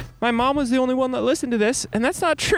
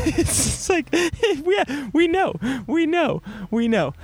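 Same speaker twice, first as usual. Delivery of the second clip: laughing; 6 semitones higher than usual. Retold or imitated. Laughing retelling.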